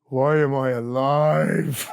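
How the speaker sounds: slow, drawn-out mumble, a lightly voiced [name]; a hint of [name], slow and world-weary; [name]'s weary voice. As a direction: drag each word out like a tired rocker. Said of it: "He goes".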